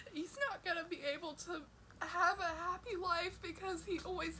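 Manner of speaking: blubbering. Real, not acted. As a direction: let the sobs break the words. Crying about partner's burden.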